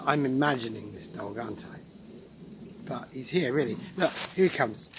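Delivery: ordinary delivery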